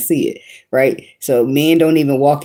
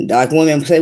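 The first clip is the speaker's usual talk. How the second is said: ordinary talk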